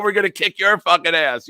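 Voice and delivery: high, loud mock threat; acted trash talk. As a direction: throw banter at a rival fan. Acts out wanted banter.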